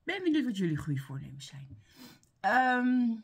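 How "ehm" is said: about to sneeze; breath catching before a sneeze; holding back a sneeze. Real, not acted. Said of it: "ik moet niezen".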